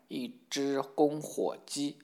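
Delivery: very slow, word-by-word jeer; drawn-out mockery. A narrator's reading. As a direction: stretch out each word of the insult. Princess mocking a red-faced suitor. (拖长字音地嘲讽)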